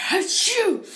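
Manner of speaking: loud high sneeze. Retold or imitated. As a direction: let the sneeze burst out. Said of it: "I sneezed once again".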